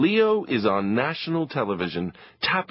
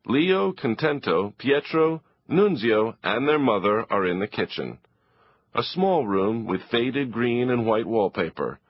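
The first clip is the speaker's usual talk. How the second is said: plain reading voice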